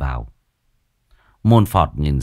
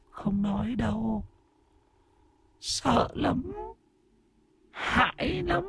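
very high, faint, trailing ghostly voice; frightened ghost; 11 dB quieter, slower than usual. A narrator's reading. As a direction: whimper softly, too scared to tell. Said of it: "ánh mắt sợ hãi nhìn quanh".

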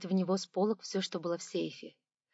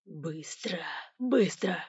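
chilling hissed whisper; threatening whispered command; menacing hiss; 2 semitones lower, much slower than usual. A narrator's reading. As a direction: hiss the order to terrify. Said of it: "прошипел тоном, навевающим ужас".

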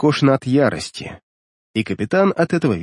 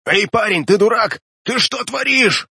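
put-on captain bellowing at a reckless young man; shouting after him; 11 semitones higher than usual. A narrator's reading. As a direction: yell after the swimmer to stop. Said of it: "крикнул мне вслед капитан".

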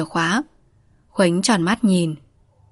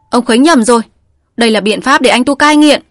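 loud, insistent correction; firm raised voice; 4 semitones higher, 6 dB louder than usual. A narrator's reading. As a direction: stand your ground and protest. Hoa stands up to Khuếnh. (giọng cao, cứng cỏi)

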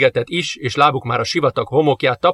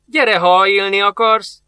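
mocking; 5 semitones higher, 3 dB louder than usual. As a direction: sneer at the foolish old man. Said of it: "gúnyosan felnevetett".

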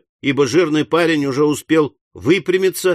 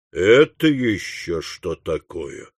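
low, slow, stunned question; deep-pitched disbelief; astonished question; 7 semitones lower, slower than usual. Acted. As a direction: drop low and stretch each word. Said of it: "воскликнул Уордль".

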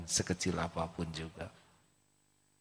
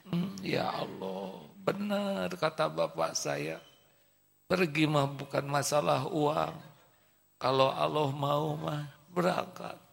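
tearful prayer; 7 semitones higher than usual. Acted. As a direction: pray through tears, hushed. Boy cries, prays in car. (berdoa sambil menangis pelan)

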